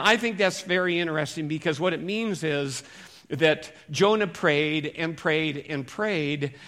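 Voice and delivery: ordinary talk, nothing marked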